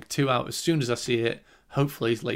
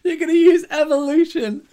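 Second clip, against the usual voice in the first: much higher, louder squeaky incredulous voice; mock disbelief; 10 dB louder than usual. Mocking the film's characters with a squeaky, disbelieving high voice. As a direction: squeal it like you can't believe your ears. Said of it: "He's going".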